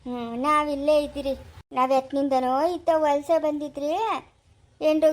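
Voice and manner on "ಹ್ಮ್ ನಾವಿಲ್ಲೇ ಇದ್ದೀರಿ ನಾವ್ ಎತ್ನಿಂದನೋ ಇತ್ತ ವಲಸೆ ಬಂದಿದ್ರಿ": usual speaking voice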